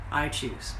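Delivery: a quiet self-affirming echo; hushed repeat. Real, not acted. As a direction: let I choose fall to a soft inward echo. Repeated affirmation, bold then hushed.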